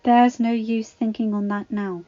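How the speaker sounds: chiding. A narrator's reading. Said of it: "Missus Potts chided".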